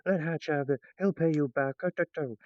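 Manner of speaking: mock chorus of humans begging; humans pleading. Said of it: "the humans are like".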